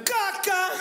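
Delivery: high, clipped mock reply of Caca; mock mother retort. Fed-up mother answering the child about dinner. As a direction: snap it like a fed-up mom. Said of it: "madres escatológicas".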